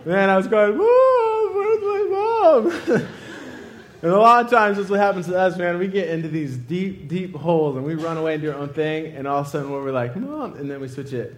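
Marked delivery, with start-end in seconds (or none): 0.8-3.1 put-on high, drawn-out childish cry; mock wailing. Shaken patient crying for mom, high wail. Boy wailing for his mother. 10.1-10.5 small plea. Retold or imitated. Imitates a plea for mom.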